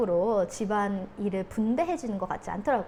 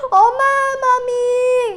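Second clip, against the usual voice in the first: put-on loud, high, drawn-out child's wail; mock crying child; 14 semitones higher, 12 dB louder, much slower than usual. Child calling mom over a lost toy, mock wailing. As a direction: imitate a kid bawling for mom. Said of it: "막 울면서".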